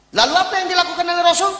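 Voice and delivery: usual speaking voice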